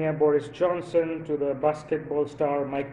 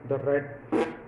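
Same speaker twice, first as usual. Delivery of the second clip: Morpheus; 4 semitones lower than usual. Pretend. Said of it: "Morpheus would go something like this".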